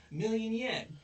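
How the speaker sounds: high, loud exclaimed sum; big, high-pitched mock demand. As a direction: announce an outrageous price, hammed up. Acted demand, repeated after correcting himself.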